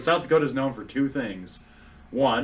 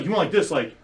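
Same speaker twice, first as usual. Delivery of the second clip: ordinary talk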